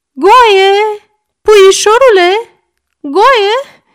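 high, very loud drawn-out calls; alarmed shouting. A narrator's reading. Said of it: "strigă tanti Mița". (strigăte alarmate, prelungi)

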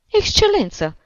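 high, loud, urgent appeal; old woman's piercing call; pouncing cry. A narrator's reading. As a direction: cry out shrilly to a superior. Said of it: "se repezi Șciuchina".

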